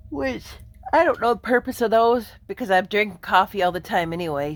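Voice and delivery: normal talking voice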